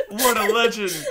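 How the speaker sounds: said through loud laughter; laughing. Real, not acted. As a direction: laugh out the words. Laughing reaction.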